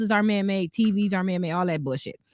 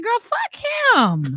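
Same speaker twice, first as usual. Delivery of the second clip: put-on very high, emphatic girl-to-girl outrage; high-pitched indignant retelling; retold outrage; 13 semitones higher, 3 dB louder, much slower than usual. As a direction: squeal the advice in mock indignation. Retold advice to stranger's girlfriend.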